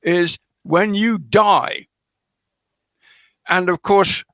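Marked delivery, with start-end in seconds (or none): 0.6-1.9 slow, weighty. Retold or imitated. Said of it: "carefully not saying".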